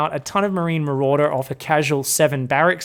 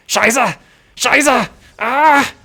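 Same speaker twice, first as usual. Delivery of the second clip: loud, high mock cursing; imagined German swearing; 8 semitones higher, 5 dB louder, much slower than usual. Commentator voices a player swearing in German, mock-angry outburst. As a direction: curse as a frustrated player in German. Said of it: "a few German swear words are coming out".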